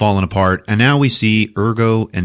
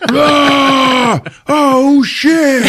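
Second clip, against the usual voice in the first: long high mock scream; hammed-up agony, loud and drawn out; screaming beast; 6 dB louder, much slower than usual. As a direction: wail like a burning monster. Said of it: "The beast is like".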